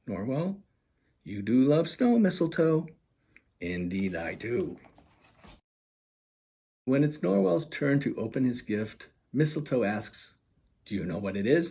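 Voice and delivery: lively storybook reading voice; animated children's book narration; story narration. A narrator's reading. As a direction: read it like bedtime picture book. Author reads picture book aloud.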